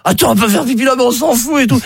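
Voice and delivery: high-pitched mock tipsy women; tipsy girlfriends. Tipsy friend tells the other she needs to pee, high-pitched. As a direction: play two drunk girlfriends in a high voice. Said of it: "elles sont bourrées".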